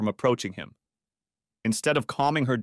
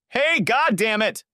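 high, drawn-out angry call; angry outburst; 12 semitones higher, 4 dB louder, slower than usual. A narrator's reading. Wife calling after departing deputy, angry outburst. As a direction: yell after him in exasperation.

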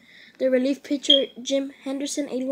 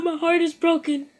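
louder, higher mock-anguished lament; a fan mourning the missed postseason, overdone; hammed-up heartbreak; 4 semitones higher, 6 dB louder than usual.

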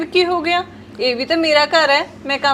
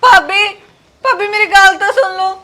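louder, calling after her desperately; urgent pleading call; 4 semitones higher, 5 dB louder than usual. Acted. Sister calling after her angry sister-in-law, pleading loudly. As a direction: call her back, begging to be heard.

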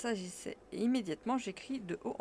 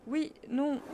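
flustered, higher stammer; 7 semitones higher, 5 dB louder than usual. Acted. Embarrassed nephew stammering to his uncle, higher. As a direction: caught out, stumbling over the answer. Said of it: "balbutiai-je".

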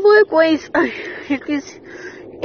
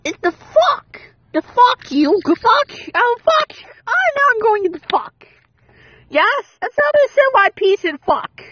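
higher, exasperated swearing at the game; frustrated cursing; 7 semitones higher than usual. Real, not acted. Raging at the game.

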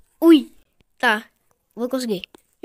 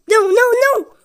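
high, loud rapid refusals; panicked protest; 9 semitones higher, 6 dB louder than usual. Real, not acted. Gamer nearly out of the round, high loud panic. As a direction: fire off high, loud no's. Losing at the last moment.

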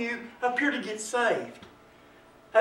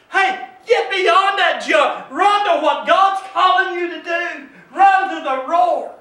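higher, urgent preacher's delivery; preaching at full force; 7 semitones higher, 7 dB louder, slower than usual. Real, not acted. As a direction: urge the congregation on.

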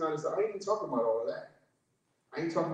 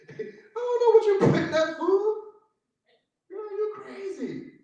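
high, laughing mock refusal; laughing disbelief; 4 dB louder than usual. Preacher refuses a friend's food, laughing in high disbelief.

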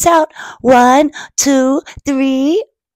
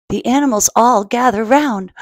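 usual speaking voice

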